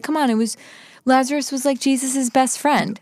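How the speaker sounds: mock-retell a husband trying to win her over; persuading. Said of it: "He was trying to sell it to her".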